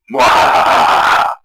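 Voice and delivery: loud, slow evil cackle; big slow maniacal laugh; evil villain laugh. Acted. Villain laughs after threat.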